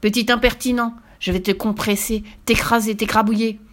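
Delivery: crush him with your words; angry witch threat. Acted.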